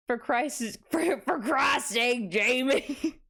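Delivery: slow, lightly voiced exasperated cowboy; a hint of Arthur Morgan, laughing through it; Arthur Morgan impression. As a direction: grumble it like a weary outlaw. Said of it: "Perfect Arthur Morgan".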